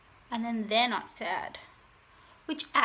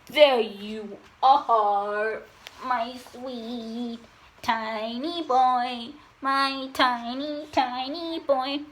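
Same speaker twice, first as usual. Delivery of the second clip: loud, drawn-out cooing; doting pet talk; 6 dB louder, much slower than usual. Real, not acted. Owner to her tiny puppy boy, doting baby talk. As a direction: stretch every word out for a puppy.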